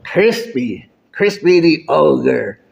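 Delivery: strained ogre voice with scorched vocal cords; damaged, straining creature voice; burned-throat ogre. Acted. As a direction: talk like an ogre whose voice got burned. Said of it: "it burned his vocals".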